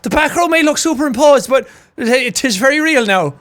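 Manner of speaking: put-on Cork Irish accent. Streamer mimicking the Cork rower, Cork accent. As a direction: play up the Cork lilt. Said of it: "I'll do that now whenever".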